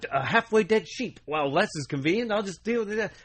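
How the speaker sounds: lightly put-on Australian accent with a high opening; a loose, hammed-up Australian accent; mock Australian survivalist accent; vague British-Australian accent. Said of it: "I don't know what accent I'm doing right now".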